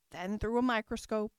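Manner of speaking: ordinary delivery, nothing marked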